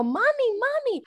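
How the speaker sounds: put-on a little girl calling for mommy in a store; imitating a toddler. Toddler lost in Best Buy calling for mommy, high child's voice.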